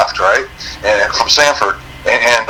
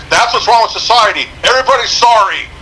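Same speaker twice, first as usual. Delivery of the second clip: yelling trooper; 10 semitones higher than usual. Retold or imitated. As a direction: shout across lanes like an annoyed trooper. Imitating trooper's retort.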